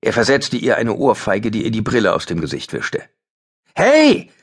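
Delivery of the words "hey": very high, loud yell of hey; scream. Acted. Ben screams at the attacker again, very high and loud. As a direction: scream it while starting to run. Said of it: "schrie Ben erneut".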